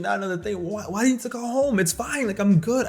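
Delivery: put-on high, fast panicky kid pleading; panicky protest. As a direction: blurt it out high and fast, trying not to get sent home. Kid panicking about call home.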